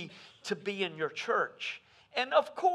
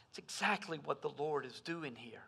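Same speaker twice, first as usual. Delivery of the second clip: soft whisper; 5 semitones lower, 9 dB quieter than usual. Acted. Preacher drops voice for point.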